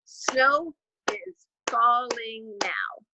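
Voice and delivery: very slow, one beat per syllable; clapped out by syllable. Shown as a demonstration. Teacher to class, slow syllable-by-syllable clapping. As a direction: clap each syllable to the grade twos slowly. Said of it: "we'll try it again".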